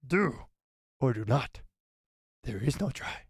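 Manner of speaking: a hint of croaky Yoda; lightly voiced Yoda; Yoda impression. Shown as a demonstration. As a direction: try out a wise little master. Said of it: "that wasn't half bad".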